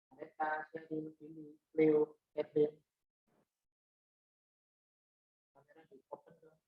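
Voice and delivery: faint, breaking-up connection; choppy audio. Real, not acted. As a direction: voice cutting in and out over a bad call. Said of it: "Suaranya putus-putus".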